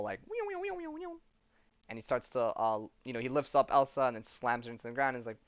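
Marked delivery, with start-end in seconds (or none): 0.3-1.1 very high squeaky cat meows; imitated meowing. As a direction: meow it like a cartoon cat. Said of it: "he does that whole".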